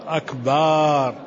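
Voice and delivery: "أكبار" with the "ba" stretched to a long "baa", slowly; lengthened ba. Shown as a demonstration. Teacher mimicking common people who lengthen the ba, slow. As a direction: drag the vowel after the b in "أكبار". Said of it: "يمد الباء".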